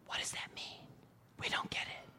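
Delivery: unvoiced disciples whispering to each other. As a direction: hush it like a secret aside.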